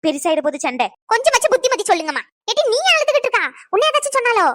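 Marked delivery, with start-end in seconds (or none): 1.1-2.2 heated rebuke. Acted. Repeated rebuke line, heated. 2.5-4.5 alarmed. Acted. Mother sees daughter crying.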